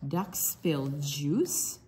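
plain reading voice

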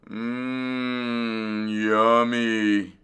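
long, drawn-out savoring hum; dreamy mumble. A narrator's reading. Gassed fox dreaming of a meal, mumbling. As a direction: relish it half asleep. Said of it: "he mumbled".

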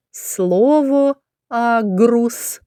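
very slow, clear "Слово місяць"; slow teacher's dictation; slow word naming. Shown as a demonstration. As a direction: sound out the word for children. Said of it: "teacher sounding out the word".

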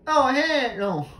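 high, loud panicked shout; scared shout. Real, not acted. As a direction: cry it out as the monster appears. Jumpscare in horror game.

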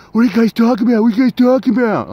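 high, loud mock voice of an accusing woman; mocking an angry woman. Woman at the bus stop confronting the guys, in a high, loud mocking voice. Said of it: "bitch, and be like".

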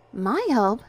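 high, loud puzzled question; taken aback, voice lifted; bewildered. A narrator's reading. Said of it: "she asked, bewildered".

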